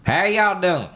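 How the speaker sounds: high, put-on Southern accent; folksy Southern accent greeting. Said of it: "Code switch".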